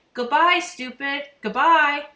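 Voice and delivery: high, loud, slow squawk; bird calling out. A narrator's reading. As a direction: call it out like a cheeky mynah. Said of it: "Uncle Feather called out".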